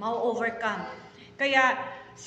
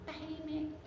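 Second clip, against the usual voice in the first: quiet; 4 semitones higher, 12 dB quieter than usual. Shown as a demonstration. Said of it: "matuto ka, maging tahimik".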